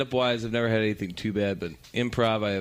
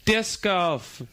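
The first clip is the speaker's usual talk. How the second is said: higher, bright disc golf offer; hopeful suggestion; 8 semitones higher than usual. Retold or imitated. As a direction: pitch it cheerfully to save the room. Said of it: "I was like".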